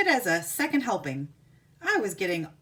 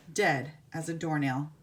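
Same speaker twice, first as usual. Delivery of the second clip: ordinary delivery, nothing marked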